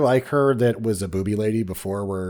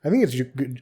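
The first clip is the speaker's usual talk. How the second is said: ordinary talk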